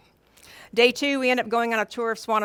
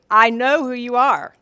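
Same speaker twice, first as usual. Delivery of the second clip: higher, louder and slow, each word weighted; knowing parting line; 5 semitones higher, 11 dB louder, slower than usual. Retold or imitated. The stranger walking off, loud and knowing. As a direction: leave it hanging like a prophecy. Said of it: "and says".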